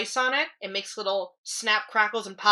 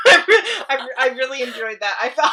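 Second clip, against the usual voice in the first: laughing; 4 semitones higher, 9 dB louder, faster than usual. Real, not acted. Laughing through praise.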